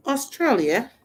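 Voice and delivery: calling out Australia, mate in a hammed-up Australian accent; mock Australian accent. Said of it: "I'm not good at accents".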